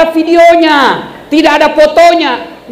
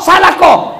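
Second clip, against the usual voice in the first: rude rebuke; 3 semitones higher than usual. Shown as a demonstration. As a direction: shout the imam down rudely. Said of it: "bukan kau".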